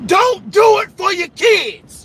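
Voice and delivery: preacher's loud rebuke; forceful command. Real, not acted. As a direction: bark it at the crowd, slowly.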